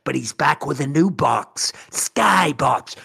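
slow dramatic announcer; mock intro voice-over. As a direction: announce the big comeback like a trailer. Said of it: "That's how my intro would be".

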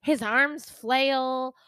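each word stretched out slowly; slow, deliberate relish on every word; slow emphasis. Shown as a demonstration. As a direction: drag it out for comic effect. Describing his running, drawn out.

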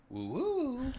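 high answering woo woo; alien sound. Pretend. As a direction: hoot back like the alien. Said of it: "I would probably reply with a".

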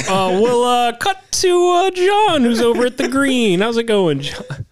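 high, slow TV announcer. Retold or imitated. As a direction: play a flustered golf announcer. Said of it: "that reporter voice you just did".

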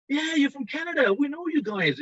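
high-pitched, mock-excited greeting from booth visitors; excited recognition. Retold visitors recognizing the company.

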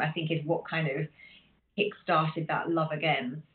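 ordinary talk, nothing marked

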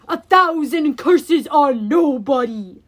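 loud, slow monster threat; raging Cyclops. Acted. Cyclops threatens the fleeing heroes, loud slow roar. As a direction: bellow it like a lumbering giant.

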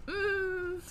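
high, soft "mm"; high-pitched hum of doubt; disapproving hum. Acted. Viewer reacting to a friend's misstep, disapproving hum.

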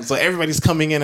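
normal talking voice

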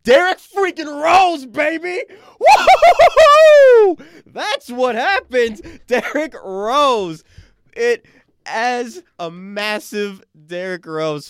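screaming high with laughter; ecstatic shout. Real, not acted. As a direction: blow up with joy. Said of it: "Look at that audio".

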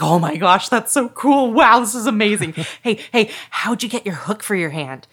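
put-on high, loud, awed little-boy gush; excited kid. Kid amazed by the pirate's story, excited. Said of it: "And the kid's like".

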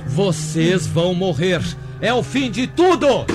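menacing threat. Acted. Madman threatening his captives.

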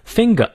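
"Finger" ending in a schwa with no R; British, silent R. Shown as a demonstration. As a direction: drop the last R of "Finger", British style. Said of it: "那个R不念".

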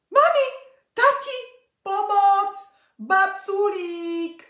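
high, loud panicked cry for help, last word slow; scared shout. Acted. Frightened girl calling her parents for help, shouting. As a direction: yell for mum and dad in panic. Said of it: "vykřikla Verča".

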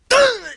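high, loud grunt of effort; imitated straining grunt. Acting out lifting the garage door, straining grunt. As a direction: grunt as if heaving with all your force. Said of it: "you go and use all your force".

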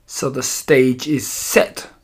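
ordinary delivery

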